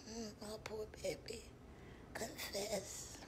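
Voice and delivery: breathy whisper, quick and hushed. Acted. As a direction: soothe her in a hush. Comforting a caller in whisper.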